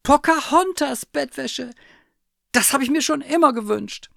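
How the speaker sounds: very high, loud squeal of joy; delighted cry. A narrator's reading. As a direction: cry out high, thrilled by the gift. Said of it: "rief die Wanderkatze".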